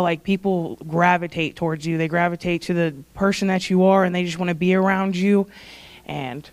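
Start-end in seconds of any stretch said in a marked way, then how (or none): 6.1-6.5 real choked-up, low and soft; holding back tears. Real, not acted. Speaker telling Dawson she loves him, tearful and hushed. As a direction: barely get it out, fighting tears. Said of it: "I'm trying not to cry".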